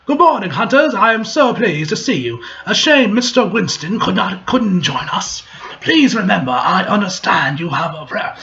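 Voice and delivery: a lightly put-on British host's accent, welcoming. Game host greets the hunters in a mock British accent. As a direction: play a genteel English lodge host, hint of British. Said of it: "I don't even know what fucking British accent that even is".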